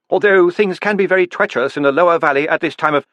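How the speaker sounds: a nervous host starting a warning; husband cautioning. A narrator's reading. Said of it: "her husband began".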